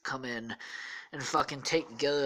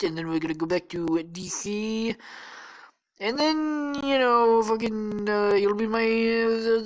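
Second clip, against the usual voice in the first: stretched words, stalling and groping for the next one; Donald Trump; 6 semitones higher, 3 dB louder than usual. Acted. Trump lays out the travel plan, hammy rambling impression. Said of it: "Trump is like".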